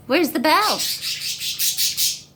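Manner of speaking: a long teasing croon; drawn-out singsong. Acted. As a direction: sing the question out long to the dog. Coaxing dog, drawn-out repeat.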